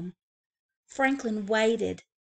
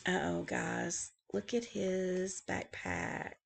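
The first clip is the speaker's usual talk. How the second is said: softer aside to the listeners; hushed aside; 7 dB quieter, slower than usual. Real, not acted. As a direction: lean in and point it out. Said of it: "reader aside to kids".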